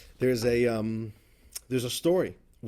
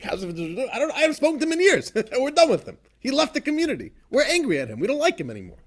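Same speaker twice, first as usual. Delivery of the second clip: scoffing dismissal; 12 semitones higher, 8 dB louder, faster than usual. Retold or imitated. Chassid scoffs about the outcast.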